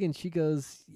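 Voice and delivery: usual speaking voice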